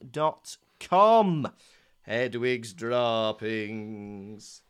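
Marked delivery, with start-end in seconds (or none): 2.1-4.6 put-on voice. Slow put-on voice.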